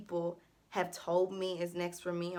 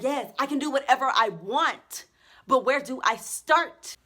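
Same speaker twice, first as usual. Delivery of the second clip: higher, louder overwhelmed cry; overwhelmed panic; 6 semitones higher, 9 dB louder than usual. Real, not acted. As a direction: blurt it out, overwhelmed.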